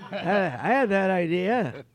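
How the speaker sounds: slow, high, loud impression; Norm Macdonald. Norm claiming the idea, high drawn-out impression. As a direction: stretch it out, insisting, in his voice. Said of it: "He goes".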